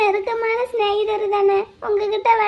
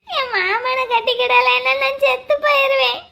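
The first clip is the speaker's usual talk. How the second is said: tearful, higher-pitched pleading; crying; 3 semitones higher than usual. Acted. Girl refusing a forced match before the tea seller, crying. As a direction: wail it through sobs. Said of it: "முதல்ல அழுகை நிறுத்துமா".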